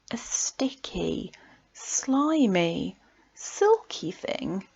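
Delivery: slow, hushed whisper; a creeping whisper. Acted. Creepy discovery in poem.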